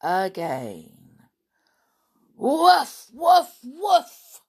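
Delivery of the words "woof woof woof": high, loud woofs; three loud yaps; dog barking. Acted. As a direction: bark like a big excited dog. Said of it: "Timmy barked again".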